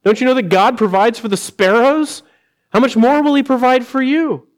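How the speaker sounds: Jesus gently questioning. Retold or imitated. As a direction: urge the worried disciples not to fear. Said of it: "where he said".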